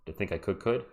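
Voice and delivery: I think I could said very low and soft; deep voiceover voice. Shown as a demonstration. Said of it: "would have to be deeper voice stuff".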